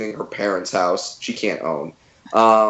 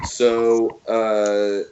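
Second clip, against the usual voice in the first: ordinary talk, nothing marked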